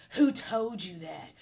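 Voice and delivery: slow, drawn-out mock demand; mock indignation. Acting out a man hearing gossip about himself, slow mock indignation. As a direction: stretch each word of the question in mock offence.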